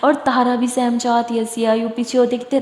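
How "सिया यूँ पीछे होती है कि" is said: ordinary delivery, nothing marked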